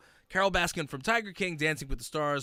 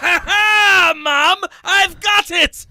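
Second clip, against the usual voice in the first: louder, higher victory shout; triumphant yell; 13 dB louder, much slower than usual. Retold or imitated. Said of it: "I screamed it".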